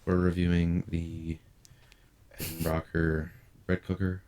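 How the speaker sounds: slow, dull product-review intro; boring reviewer. Pretend. Mocking a dull YouTuber, slow and dull. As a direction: drone through it like a bored reviewer. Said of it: "I'm sitting there like".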